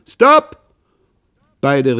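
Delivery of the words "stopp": sharp, very high, loud shout; commanded stop. A narrator's reading. Old father halting the rowing race, sharp loud shout. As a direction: cut the race off with one yell. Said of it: "der Alte musste schließlich kommandieren".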